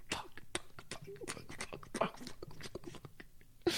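a string of whispered curses breaking into high cries; whispered climax cries. Acted. Lover climaxing again, cursing in a broken whisper.

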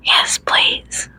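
soft, whispery plea; desperate begging. A narrator's reading. Narrator voices the character begging, desperate and hushed. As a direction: beg under your breath, desperately. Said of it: "my voice shaky with desperation".

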